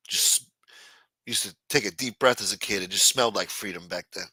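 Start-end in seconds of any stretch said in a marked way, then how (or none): none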